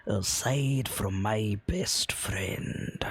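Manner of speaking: put-on the old dwarf, turning wary, last words whispered; old dwarf whispering that only his friend may find him. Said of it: "do the voice".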